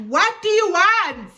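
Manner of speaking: loud, high, slow, drawn-out yell at him; annoyed yell. Retold or imitated. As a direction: yell it to make him leave you alone. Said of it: "now I'm yelling 'cause I'm annoyed".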